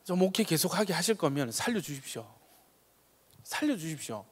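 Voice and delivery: soft, hushed plea to God; hushed earnest prayer; pleading prayer. Retold or imitated. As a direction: beg quietly for a life. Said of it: "그때 기도했습니다".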